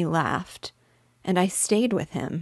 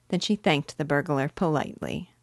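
plain reading voice

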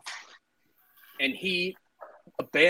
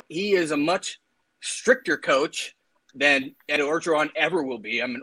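ordinary talk

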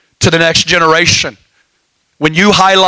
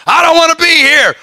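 put-on high whiny kid voice, pitched up; a pouting child protesting family worship; 10 semitones higher than usual. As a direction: mimic a kid who doesn't want to be there. Kid's whiny reply voiced.

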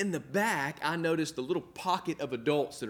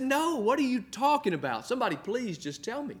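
higher, pleading "just tell me"; lightly imitating his own begging; retold exasperation; 7 semitones higher than usual. Pastor begging the team to explain the laughter, higher and pleading.

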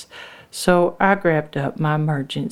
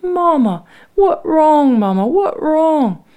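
high, loud cries of alarm; crying child; 12 semitones higher, 8 dB louder than usual. A narrator's reading. Lucy crying to her mama in labor, high alarmed wail. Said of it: "Lucy started crying up".

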